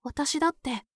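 usual speaking voice

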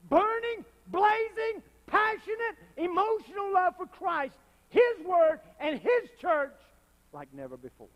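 each word high and loud; fervent list. Real, not acted. Preacher stirring the church, high and loud. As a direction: pile on the passion.

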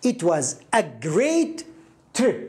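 it was a great trip, very slow, word by word; slow model reading. Shown as a demonstration. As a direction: read it out slowly for the learner. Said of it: "حاول أن تقرأ".

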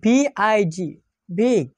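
B-I-G spelled out, then বিগ said as big. Shown as a demonstration. Teacher spelling out B-I-G for students, then saying big. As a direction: spell বিআইজি letter by letter, then say বিগ with a short i. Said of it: "যেমন আমি এখানে কয়েকটি শব্দ লিখেছি".

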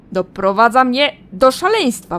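loud, tormented pleading that breaks into a yell; a woman driven mad by a voice in her head; frantic madwoman. Acted. Karsa begs the voice to stop, frantic and loud.

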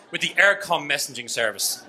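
ordinary delivery

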